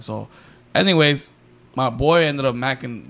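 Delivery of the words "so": ordinary talk, nothing marked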